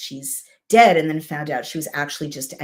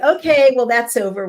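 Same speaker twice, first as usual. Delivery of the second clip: higher and louder, a breezy mock "okay"; mock cheerful shrug; 7 semitones higher, 7 dB louder than usual. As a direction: toss it off like a careless crowd, lightly hammed. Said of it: "They're like".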